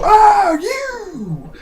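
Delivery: loud, high-pitched squawk of recognition; hammed-up startled shout; mock shocked recognition. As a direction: yelp it like spotting an old acquaintance.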